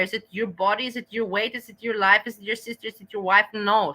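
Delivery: ordinary talk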